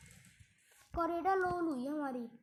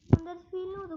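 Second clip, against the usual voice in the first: ordinary talk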